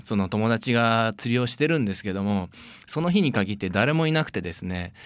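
hushed ghost story telling; spooky storytelling. Acted. Tells ghost story segment.

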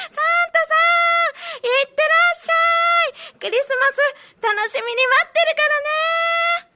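put-on high, bright child calling out; excited shouting child. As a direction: call after Santa with joy. Child shouts to departing Santa.

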